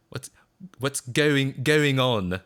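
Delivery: mock British vowel in "going"; exaggerated British O. Streamer mocking Americans' failed Aussie accent, exaggerated British O. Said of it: "an exaggerated British O".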